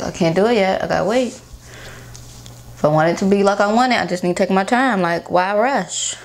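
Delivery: sheet mask on face; speaking with face mask on; talking through sheet face mask. Real, not acted. Seen 1: wet sheet mask covers face, mouth area cut open.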